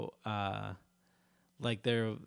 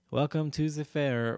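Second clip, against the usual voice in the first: loud, raised mock French accent; 4 semitones higher, 8 dB louder than usual. Fair worker welcomes a visitor in character, French accent. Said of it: "with a French accent".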